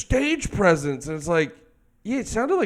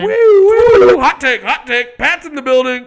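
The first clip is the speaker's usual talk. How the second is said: high, loud siren whoops, then hype; mock alarm announcer; 9 semitones higher, 8 dB louder than usual. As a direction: sound the hot-take alarm. Mock alarm announcing Hot Take Pat.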